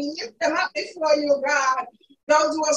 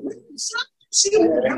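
fervent praise; 7 semitones higher than usual. Real, not acted. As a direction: cry out thanks to God at full power.

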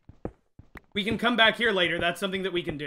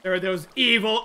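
ordinary talk, nothing marked

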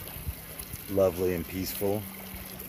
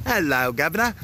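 hammed-up British accent, pitched higher; mock British accent; 7 semitones higher than usual. Vlogger playing Johnny Top Hat, mock British accent. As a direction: play a cartoon Brit, chirpy and over the top. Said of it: "and I have an accent".

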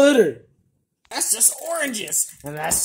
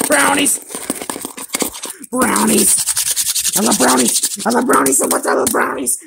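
loud, raised gleeful exclamations; excited gushing; 4 semitones higher, 5 dB louder than usual. Acted. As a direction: shout your love with glee. Declares love for brownies excitedly.

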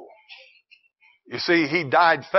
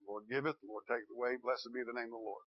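hushed, much softer reply; almost whispered, humble; Job's quiet faith; 3 semitones lower, 18 dB quieter, faster than usual. Acted. Preacher voices Job answering softly, hushed. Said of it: "Job just faithful".

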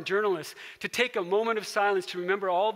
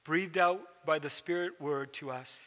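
closing prayer; 3 semitones lower than usual. Real, not acted. Pastor leads closing prayer.

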